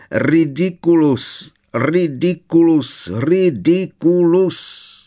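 desperate repeated wail climbing up; wailing spell. A narrator's reading. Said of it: "zakvílala paní Weasleyová". (kvílivě, stále výš a hlasitěji)

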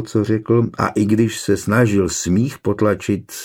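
plain reading voice

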